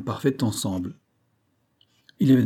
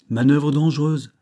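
higher, louder note of warning; raised-voice caution; cautioning remark; 5 semitones higher, 5 dB louder than usual. A narrator's reading. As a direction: voice a mild warning to a comrade. Banks warns the captain in dialogue.

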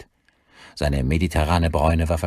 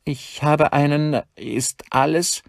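high, slow faltering voice; nervous secretary; 11 semitones higher, slower than usual. A narrator's reading. Secretary peeks in and sees a gun, nervous high falter. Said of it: "steckte nervös den Kopf herein".

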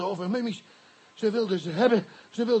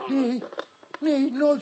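protest; 5 semitones higher, much slower than usual. Acted. Witness protests being taken away.

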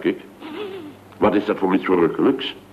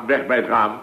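ordinary talk

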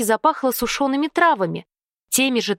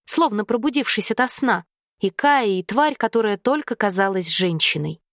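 ordinary delivery

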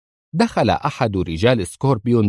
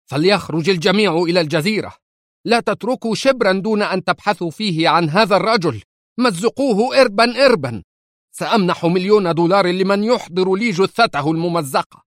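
much higher, raging commands; furious orders; 10 semitones higher than usual. Acted. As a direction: bark orders at the men in fury. Furious boss orders his men. (أوامر غاضبة صارخة)

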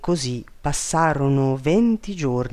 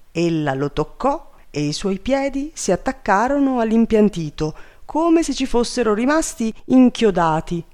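plain reading voice

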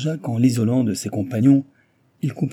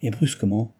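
ordinary delivery, nothing marked

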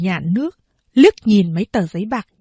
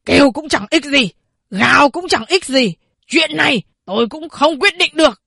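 higher, louder, harsh retort; angry shout; 5 semitones higher, 4 dB louder than usual. A narrator's reading. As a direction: shout him down. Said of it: "giận dữ quát".

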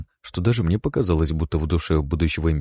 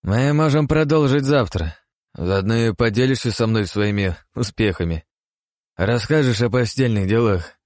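lecherous chuckle; 5 semitones higher than usual. Acted. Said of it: "похотливо усмехнувшись".

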